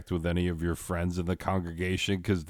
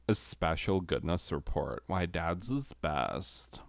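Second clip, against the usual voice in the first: that sultry voice; 2 semitones lower, 5 dB quieter than usual. Acted. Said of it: "definitely do that voice on this slide".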